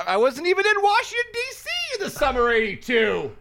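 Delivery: very high, loud squeal of protest; hammed-up indignant denial; shrill Kavanaugh impression. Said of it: "He's like".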